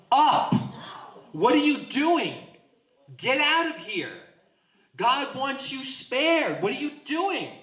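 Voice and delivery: high, exasperated urging; urgent angels. Acted. Angels rushing Lot out of the doomed city, urgently. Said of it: "they're like".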